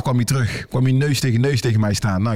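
ordinary talk

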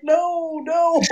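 mock protest. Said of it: "They were like".

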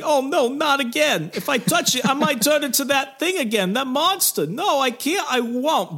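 high-pitched mock anguish; hammed-up distraught hero. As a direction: agonize melodramatically in a put-on young man's voice. Danny's voice resumed, dreading transformation.

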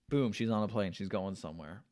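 usual speaking voice

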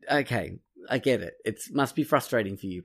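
ordinary delivery

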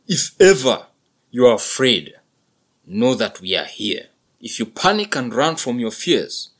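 loud, steady protective bull; reassuring buffalo father. A narrator's reading. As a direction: promise the herd has his back. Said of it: "father reassures son".

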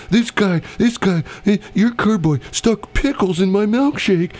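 high, whiny outraged complaint; be the upset customer pointing blame; angry customer yelling. Retold or imitated.

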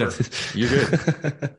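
laughing through the reassurance; laughing while telling the guest he's fine. Real, not acted. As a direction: chuckle it out kindly. Host laughing.

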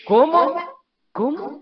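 high, loud shocked "¿Cómo?". Retold or imitated. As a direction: gasp out the disbelief. Retold shock at being turned away.

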